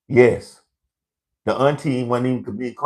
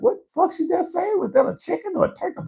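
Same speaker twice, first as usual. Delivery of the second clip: incredulous inner voice. Retold or imitated. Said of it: "I'm like".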